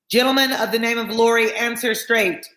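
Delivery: high, sustained official call. A narrator's reading. Guard demanding passenger Lorry answer, calling out. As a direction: call out a formal order.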